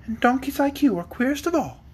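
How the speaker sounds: put-on high, light girl's voice without real laughter; high-voiced amused fairy; teasing fairy girl. A narrator's reading. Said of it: "laughed Polychrome".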